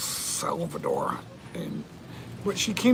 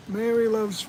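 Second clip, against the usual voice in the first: normal talking voice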